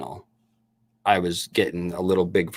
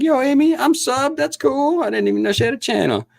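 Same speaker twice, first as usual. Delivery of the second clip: very high, gushing put-on voice; mock excited reply. Retelling his reply to Amy in the live chat, hammed-up excitement. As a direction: play it up as a squeaky, eager fan.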